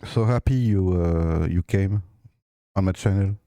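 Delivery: English spoken with a strong French accent; French speaker talking English. Real, not acted. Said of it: "j'ai un accent anglais très, très pourri".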